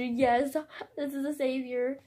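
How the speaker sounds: normal talking voice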